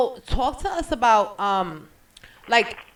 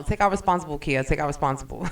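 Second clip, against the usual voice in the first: teasing joke; 6 semitones lower than usual. Pretend. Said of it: "I joke".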